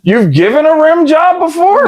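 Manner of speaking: high rising mock-shocked question; scandalised retold question; incredulous. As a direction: replay your disbelief, pitch climbing. Acted shocked question to friend.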